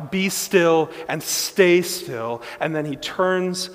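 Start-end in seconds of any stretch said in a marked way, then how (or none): 0.1-2.4 slow, loud command to the storm; commanding cry. Retold or imitated. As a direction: call it out slowly, ringing with authority. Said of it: "He's cried out".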